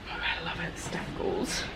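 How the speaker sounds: soft awed whisper. Real, not acted. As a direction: whisper it lovingly. Admiring t-shirt print.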